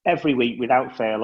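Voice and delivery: usual speaking voice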